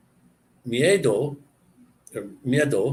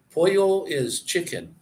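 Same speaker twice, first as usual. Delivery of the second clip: usual speaking voice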